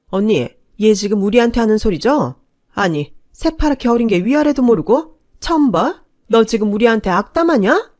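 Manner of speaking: loud, high, indignant snapping; angry retort. Acted. Aunt lashes out at the niece-in-law, loud and offended. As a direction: put the young upstart in her place. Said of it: "저를 죽일 듯 노려보며 한마디씩 하셨어요".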